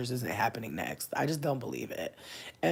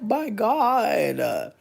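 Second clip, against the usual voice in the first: loud, high mock-shocked outburst; shocked exclamation; 8 semitones higher, 8 dB louder than usual. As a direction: cry it out high, reeling at the news. Exclaims over the graphic answer.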